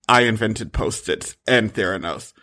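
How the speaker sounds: Elizabeth Holmes voice. Retold or imitated. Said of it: "Romy speaks like Elizabeth Holmes".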